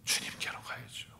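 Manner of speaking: soft voiceless whisper; hushed, intimate answer. Real, not acted. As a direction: breathe the answer out. Answer after rhetorical question.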